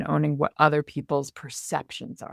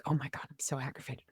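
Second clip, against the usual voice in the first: half-swallowed, much softer grumble; muttered inner voice; 4 semitones lower, 14 dB quieter than usual. Retold or imitated. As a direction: mutter it under your breath in the store. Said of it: "And I'm like".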